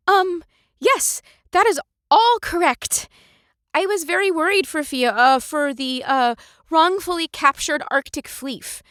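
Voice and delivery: high, eager, fretful voice; nervously eager to please; nervous witness voice. Acted. Character voice for witness.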